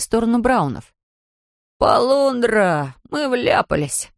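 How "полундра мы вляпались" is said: put-on slow, higher exclaiming boy's voice. A narrator's reading. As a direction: Jonathan sounding the alarm. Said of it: "воскликнул Джонатан". (воскликнуть тревожно)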